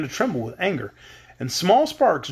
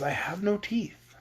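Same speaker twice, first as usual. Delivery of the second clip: whisper; 4 semitones higher, 7 dB quieter than usual. Acted. Woozy admits having no teeth.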